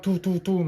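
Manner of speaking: high, quick toots; imitated shots. Man describing guards firing through slots, imitated shots. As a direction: mimic little projectiles popping out.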